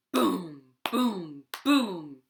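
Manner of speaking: ordinary delivery, nothing marked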